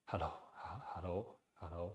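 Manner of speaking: softly whispered hellos; light breathy whisper of hello. Shown as a demonstration. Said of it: "try to almost whisper".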